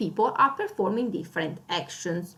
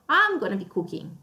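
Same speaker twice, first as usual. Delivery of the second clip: ordinary talk, nothing marked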